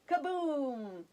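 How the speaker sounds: ordinary delivery, nothing marked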